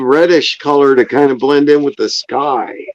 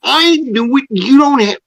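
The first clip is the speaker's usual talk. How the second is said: surprised; 12 semitones higher than usual. Real, not acted. Surprised at shared name.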